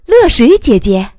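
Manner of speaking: high, loud delighted greeting; happy call. A narrator's reading. Boy spotting his older friend arriving, joyful call. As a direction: call out gladly to a big sister. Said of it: "高兴地叫".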